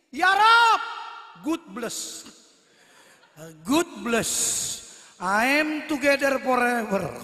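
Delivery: lightly voiced Western convert praying; a hint of a Western accent praying; foreigner speaking indonesian. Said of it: "Orang bule, baru seminggu masuk Islam".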